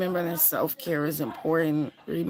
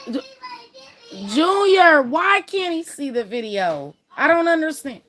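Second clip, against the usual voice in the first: high, loud call to Junior in the next room; 13 semitones higher, 13 dB louder than usual. Real, not acted. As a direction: shout across the house at her son. Yelling to son off-camera.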